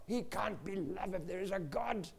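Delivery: acting out an outraged unbeliever; incredulous outrage. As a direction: protest in acted indignation. Boss's accusation against God, retold.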